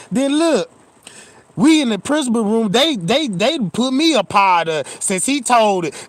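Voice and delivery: ordinary talk, nothing marked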